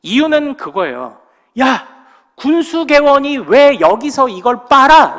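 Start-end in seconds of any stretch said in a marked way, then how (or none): 1.6-5.2 high, loud, slow yell of a senior soldier; retold yell. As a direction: drag out the scolding yell. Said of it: "폭언을 하며 소리를 질렀습니다". (높고 크고 느린 선임의 호통)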